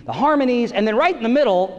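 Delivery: ordinary talk, nothing marked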